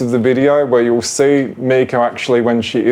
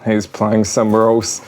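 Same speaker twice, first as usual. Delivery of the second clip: ordinary talk, nothing marked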